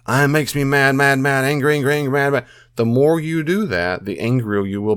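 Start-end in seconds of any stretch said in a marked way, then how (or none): 0.0-2.5 higher, repeated angry labels building up; mock angry ranting. Shown as a demonstration. Showing how over-labelling feeds anger, mock rant. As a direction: hammer the word mad over and over. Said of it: "you can label it a lot".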